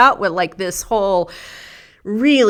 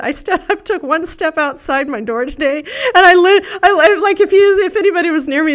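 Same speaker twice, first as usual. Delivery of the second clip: normal talking voice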